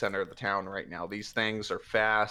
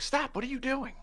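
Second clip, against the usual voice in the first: high alarmed shout; alarmed guard. Acted. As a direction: stop them in alarm. Guards stopping the party.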